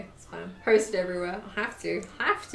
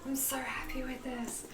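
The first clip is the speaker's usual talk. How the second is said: soft, breathless whisper of joy; happy whisper; 2 semitones higher, 11 dB quieter than usual. Real, not acted. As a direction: whisper it, quietly overcome. Overcome with pride in her bag.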